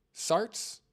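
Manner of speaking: "Sarts?" with no P sound, rising high; unsure name pronunciation. Real, not acted. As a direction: try "Sarts?" starting on S, as a question. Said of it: "I don't know how to pronounce your name".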